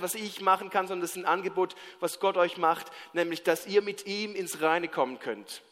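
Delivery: ordinary talk, nothing marked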